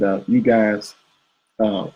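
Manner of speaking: usual speaking voice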